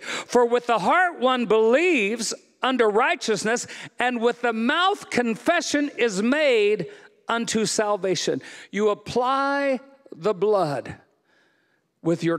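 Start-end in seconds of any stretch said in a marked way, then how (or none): none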